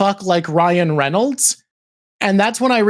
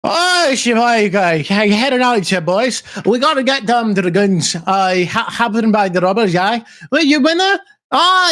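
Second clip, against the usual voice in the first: put-on drunk Newfoundland accent; 3 semitones higher than usual. Imitating a drunk Newfie, thick Newfoundland accent. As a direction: lay the Newfoundland accent on thick, like after a few drinks. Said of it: "And then they sip alcohol and they're like".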